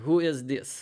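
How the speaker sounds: a mock Russian asking at the door; Russian accent. Office guard on the intercom demanding who rings, in a mock Russian accent. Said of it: "it's this Russian accent".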